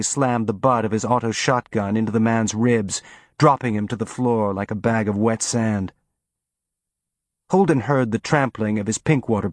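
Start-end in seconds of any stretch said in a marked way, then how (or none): none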